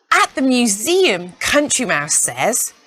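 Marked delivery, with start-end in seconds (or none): none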